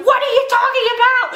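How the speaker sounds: shrill, fast and loud, like the whole internet outraged at once; mock outrage, fast and high; outraged disbelief. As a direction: squeal it in disbelief. Fans' outraged reaction quoted.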